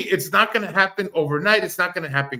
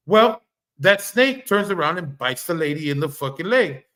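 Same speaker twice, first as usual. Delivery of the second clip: ordinary talk, nothing marked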